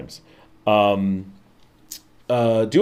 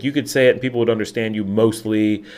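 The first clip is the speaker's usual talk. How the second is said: usual speaking voice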